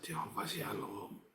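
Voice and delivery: soft, slow whispered repetition; hushed calming chant; quiet murmured prayer. Retold or imitated. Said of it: "Saya bilang begitu".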